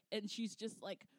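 usual speaking voice

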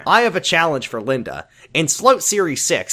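mannered reviewer read with swooping stresses; singsong, over-deliberate video narrator delivery; George Wood. Acted. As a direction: punch key words, then let each phrase fall. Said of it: "I need to read this in the correct voice".

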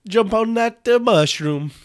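high, loud goofy game voice; cartoon game hero voice. Friend goofing as a video game plumber, high and loud.

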